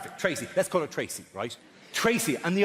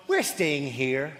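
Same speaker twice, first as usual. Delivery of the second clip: slow dramatic title; 4 semitones lower, much slower than usual. Pretend. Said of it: "A show called".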